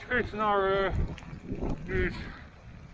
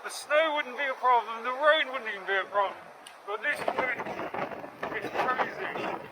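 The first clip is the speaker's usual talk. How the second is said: raised, higher voice in wind; shouting over wind; 4 semitones higher than usual. Real, not acted. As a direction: push the words over the gale. Talking over strong wind outdoors.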